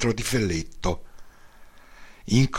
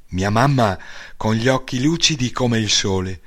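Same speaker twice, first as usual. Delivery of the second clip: usual speaking voice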